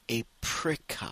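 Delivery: "apricot" with the final T sounded; final T pronounced. Shown as a demonstration. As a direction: say "apricot" slowly, voicing the last T. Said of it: "on prononce le T à la fin". (« apricot » en prononçant bien le T final)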